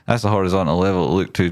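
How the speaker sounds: usual speaking voice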